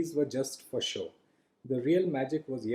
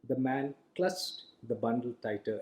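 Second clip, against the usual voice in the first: ordinary delivery, nothing marked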